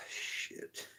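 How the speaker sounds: breathy whispered shit under the breath; barely voiced dismay; whispered curse. Real, not acted. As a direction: mutter it almost silently. Quiet dismay.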